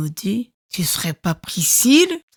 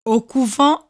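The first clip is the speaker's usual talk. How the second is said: ordinary delivery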